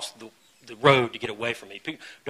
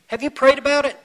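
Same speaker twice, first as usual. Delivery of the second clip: mock question; 12 semitones higher, 9 dB louder than usual. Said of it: "You know what they say".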